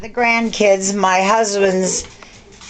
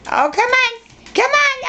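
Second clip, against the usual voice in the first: coaxing. Real, not acted. Coaxing dog to do trick.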